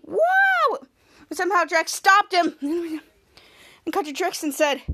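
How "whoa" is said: high startled whoa; surprised whoa. Retold or imitated. Young Ray stopped by Drex, high startled whoa. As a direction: get caught short mid-run.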